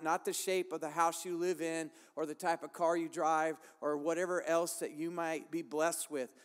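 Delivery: usual speaking voice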